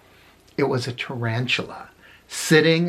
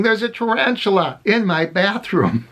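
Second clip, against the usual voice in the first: higher-pitched mock alarm; hammed-up panicky announcement, pitched up; retold panic; 7 semitones higher than usual. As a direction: imitate a flustered friend reporting an emergency. Acting out friend's panic at desk.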